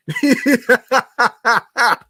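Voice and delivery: loud, high peal of laughter; laughing hard. Real, not acted. Host reacting to Dunkin' memes, laughing hard. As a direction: burst out in a real laugh.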